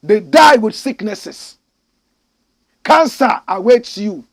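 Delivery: ordinary talk, nothing marked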